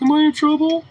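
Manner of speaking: high, squeaky voice for the startled dog; dog's inner voice. Retold or imitated. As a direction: play the dog, worried and high. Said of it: "He's like".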